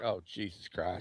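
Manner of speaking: low, slow weary groaned words; mock dismay. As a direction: sink into exasperated disbelief, acted. Imagined Bostonians watching in dismay.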